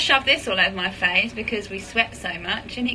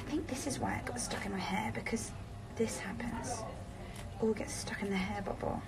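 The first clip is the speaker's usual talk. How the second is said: whispering; 14 dB quieter than usual. Real, not acted. Aside while brushing hair.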